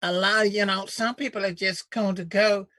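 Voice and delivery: lightly mock the senator's good old boy accent; imitating senator's drawl. Host mocking a senator's folksy Southern drawl. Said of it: "You gotta sell that accent better".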